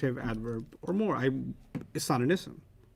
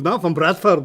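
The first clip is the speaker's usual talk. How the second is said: loud mock Scottish protest; Scottish accent; 3 semitones higher, 7 dB louder than usual. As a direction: insist loudly in an imitated Scottish accent. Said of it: "He, he really sounded Scottish".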